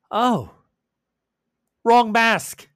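loud, high exclamation of a mistake; exclaiming masked figure. Acted. Masked figure spotting the wrong mask, loud surprised exclamation. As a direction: call out a sudden realisation.

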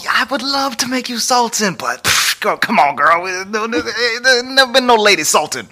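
high, fussy, flustered voice; flustered old sultan. Retold or imitated. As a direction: pitch it up like a fretting king.

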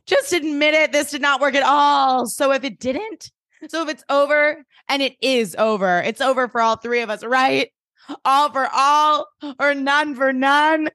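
lightly hammed-up accusation; acted dramatic confrontation. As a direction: play a melodramatic teen demanding an answer. Acted character's dramatic confrontation of friends.

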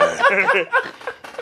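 squeaky burst of laughter; high-pitched cackle. Real, not acted. Laughing fit.

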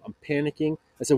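ordinary talk, nothing marked

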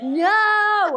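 long, high, loud "no"; retold scream. Retelling waking to a dog retching by her face, screamed. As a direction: scream it out in mock horror, drawn out. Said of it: "And I screamed".

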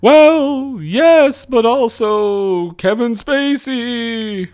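drawn-out spirit voice; ghost voice. Acted. Future self, ghostly emphasis.

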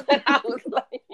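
words dissolving into laughter; laughing. Real, not acted. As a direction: crack up while talking. Cracking up at own story.

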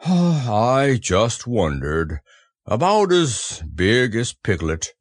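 sighing, very slow musing; sigh, then drag the words out sadly; mournful donkey talking to himself; sad Eeyore. A narrator's reading. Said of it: "he said to himself sadly".

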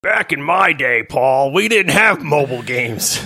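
lightly voiced grumpy old man; a hint of a cranky grandpa. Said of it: "that's my new old man voice".

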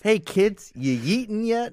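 high, upbeat try-hard mom; cool mom voice. Pretend. Mocking the AI as a mom trying to sound cool, high and try-hard. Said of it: "a mother trying to sound cool".

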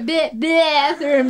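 "bathroom" with a hugely stretched first vowel; slow, drawn-out, teasing "bathroom"; mocking imitation. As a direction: parrot "bathroom" back in exaggerated mockery. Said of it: "すごいバカにされ".

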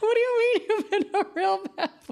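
high, laughing disbelief; incredulous. Retold or imitated. Girlfriend baffled by boyfriend's confession, high incredulous squeal. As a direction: squeal the question through a laugh. Her startled reply retold.

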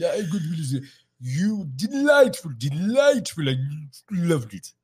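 high, loud, stretched royal praise; hammed-up royal impression, drawing out the compliments; King Charles. Then-Prince praising the second performer, hammed-up royal voice. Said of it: "he turned around and said".

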